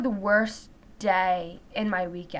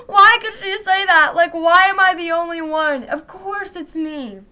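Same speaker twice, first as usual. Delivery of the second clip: high, loud tearful complaint, slowing at the end; mock crying; 5 semitones higher, 6 dB louder than usual. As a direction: reenact a tearful self-pitying outburst. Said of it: "I started crying".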